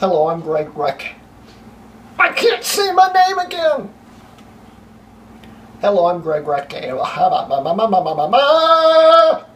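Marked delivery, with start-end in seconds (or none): 2.2-3.9 very high, strained wailing shout; frustrated wail. Real, not acted. Frustrated at flubbing own intro. 7.5-8.3 frustrated babbling. Real, not acted. Flubbed intro turns into babble.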